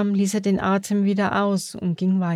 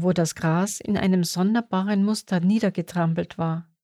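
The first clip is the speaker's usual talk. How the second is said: ordinary delivery, nothing marked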